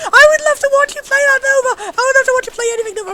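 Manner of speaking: loud, high, gushing excitement; hyperactive. Real, not acted. Streamer tells a friend she'd watch anything, hyperactive. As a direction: gush at a friend with real glee. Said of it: "I'm really hyperactive!".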